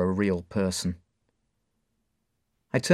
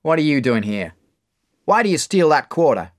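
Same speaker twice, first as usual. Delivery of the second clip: louder, higher gruff questioning; bar owner; 6 semitones higher, 7 dB louder than usual. Acted. Bar owner Mark catching the thief: loud demand. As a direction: confront the boy. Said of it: "when Mark grabs me".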